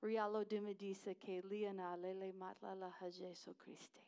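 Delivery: Rialo dumedise spoken in Tswana sounds; Tswana greeting. Shown as a demonstration. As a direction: greet them in Tswana, fluent and even. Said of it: "That's the language of our Tswana tribe".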